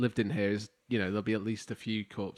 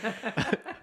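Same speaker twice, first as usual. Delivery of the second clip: high giggle; 11 semitones higher than usual. Real, not acted. Laughing about chair noise.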